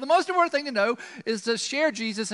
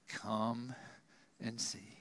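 tender invitation; 12 semitones lower, 16 dB quieter, much slower than usual. Acted. Soft invitation after the doubt.

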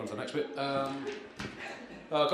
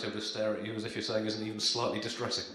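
ordinary talk, nothing marked

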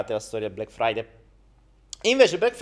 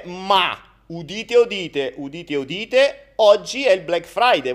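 town crier; 4 semitones higher, 7 dB louder than usual. Acted.

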